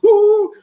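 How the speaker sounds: high loud whooping monkey call; excited MC whoops a monkey call. Retold or imitated. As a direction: whoop like a monkey to hype the room. MC acting monkey noise.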